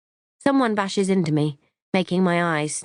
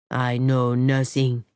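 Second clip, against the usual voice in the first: low, slow murmured French put-on; playful fake French, drawn out and low; mock French accent; 4 semitones lower, slower than usual. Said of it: "in a mock French accent".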